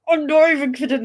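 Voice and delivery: bursting with joy; excited insistence. Real, not acted. Thrilled after getting copy.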